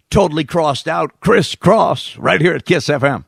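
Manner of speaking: radio DJ introduction. Retold or imitated. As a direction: hit the title like a morning DJ. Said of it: "then he played like".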